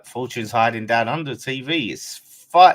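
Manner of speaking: usual speaking voice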